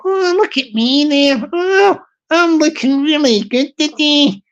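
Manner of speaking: lightly voiced vain lady admiring herself; a hint of a lady preening at her reflection. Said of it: "when the lady passed by the mirror".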